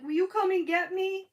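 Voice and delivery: high, loud retold plea; imitated bawling daughter. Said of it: "she calls me and she's bawling".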